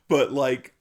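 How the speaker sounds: ordinary delivery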